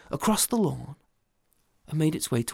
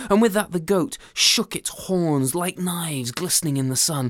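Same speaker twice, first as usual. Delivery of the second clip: plain reading voice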